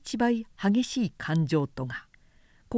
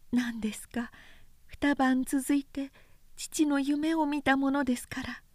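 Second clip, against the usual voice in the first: higher, soft, frail consort's voice; faint; 5 semitones higher, 5 dB quieter than usual. A narrator's reading. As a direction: speak faintly, uneasy. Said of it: "かすかに". (か細く不安げに)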